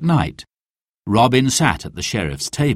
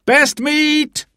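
market cry. Acted. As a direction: shout your wares to the square. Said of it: "he cried".

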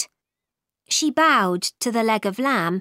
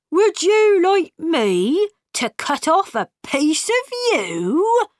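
high-pitched, offended talking pudding; indignant pudding; 8 semitones higher, 4 dB louder than usual. A narrator's reading. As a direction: protest in a high, put-on creature voice. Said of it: "cried the pudding".

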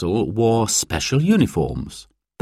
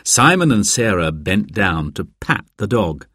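usual speaking voice

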